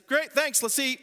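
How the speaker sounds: high, loud hurried dad; hammed-up eager dad cutting it short; mock dad rushing to eat; impatient dad. Dad rushing through to the meal.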